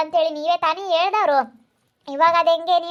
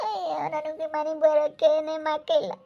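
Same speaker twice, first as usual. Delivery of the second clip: tearful lament; 5 dB quieter than usual. Acted. Sick aunt laments tearfully.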